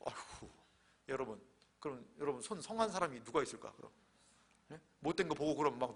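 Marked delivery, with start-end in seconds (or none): none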